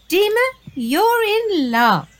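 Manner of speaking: high, slow sing-song teasing; teasing chide. Acted. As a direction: tease her in a playful sing-song. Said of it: "they all chide".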